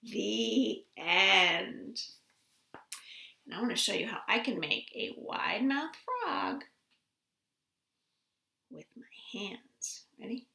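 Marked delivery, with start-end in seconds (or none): 3.4-10.5 whispered setup for a hand trick. Real, not acted. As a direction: hush it like a secret surprise. Sets up hand trick for kids.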